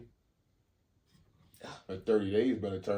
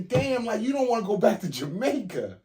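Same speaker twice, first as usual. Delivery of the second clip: high, loud incredulous outburst; real, animated disbelief; 12 semitones higher, 8 dB louder than usual. Real, not acted. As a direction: pitch and volume up, astonished. Incredulous over refusing Jamaica trip.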